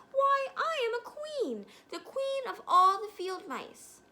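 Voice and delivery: tiny offended queen, loud and high; indignant little queen. A narrator's reading. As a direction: draw herself up as royalty.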